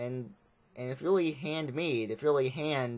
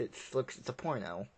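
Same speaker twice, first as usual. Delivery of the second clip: ordinary talk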